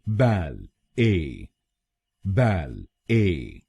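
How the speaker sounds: बैल ए very slowly, split in two; slow British syllable demo of बैल ए. Shown as a demonstration. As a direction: break it as बैल, pause, ए. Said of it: "पहले शब्दांश को यानी B ए L L हम बोलेंगे".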